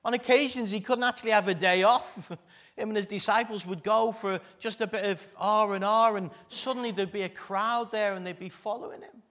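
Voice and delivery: rising, loud, fervent preaching. Acted. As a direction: preach it out with full force. Preaching builds in force.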